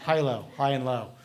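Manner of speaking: "Hilo" said like "high" plus "low". Retold or imitated. Author correcting the video director, "Hilo" said HIGH-loh. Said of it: "So is it pronounced Hilo or Hilo?".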